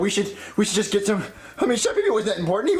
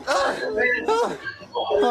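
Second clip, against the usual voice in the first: distressed cry. Acted. Cody leaves, Junior cries out.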